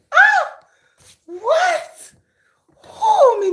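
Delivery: shrill surprised squeal; high squeal. Retold or imitated. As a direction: squeal it like you've been caught off guard.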